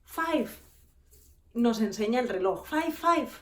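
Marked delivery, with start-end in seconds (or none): 0.0-0.5 high blurted five; mock flustered clerk; nervous clerk. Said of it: "solo decía esto y entró en pánico". 2.6-3.3 high, pointing five five; mock panicky clerk; nervous clerk. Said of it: "solo decía esto y entró en pánico".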